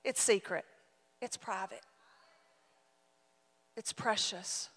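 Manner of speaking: almost confiding; hushed. Real, not acted. Preacher confiding to the congregation, hushed.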